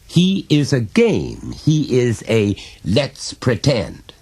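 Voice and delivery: slow, high, emphatic father; insistent father. A narrator's reading. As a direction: spell it out slowly for the child.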